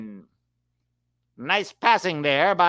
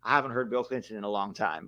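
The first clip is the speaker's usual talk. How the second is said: ordinary delivery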